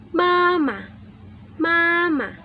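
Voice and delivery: "mama" both syllables high and level; first tone. Shown as a demonstration. Teacher giving first-tone example word, high flat "mama". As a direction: say "mama" on a high steady pitch. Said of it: "第一声".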